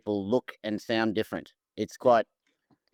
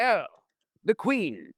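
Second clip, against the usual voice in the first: high, hammed-up English announcer; mock old radio English, pitched right up; pretend English accent; 11 semitones higher, slower than usual. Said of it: "pretending to sound English".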